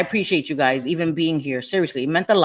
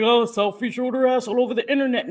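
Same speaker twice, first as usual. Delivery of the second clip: higher, mock-scandalised gossip voice; 5 semitones higher than usual. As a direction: parody the gossiping crowd. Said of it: "And people were like".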